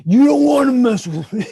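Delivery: loud, puffed-up tough-guy threat; mock tough guy. Retelling the neighbor with the chainsaw, a loud mock tough guy. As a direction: play the big bully squaring up. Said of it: "He's like".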